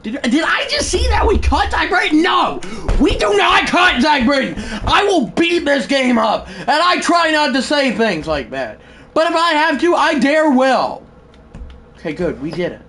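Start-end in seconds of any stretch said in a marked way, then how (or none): 0.0-11.0 real outburst, shout it as a threat; frustrated. Real, not acted. Gamer yelling at the game over a cut player, frustrated. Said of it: "Do you understand my frustration".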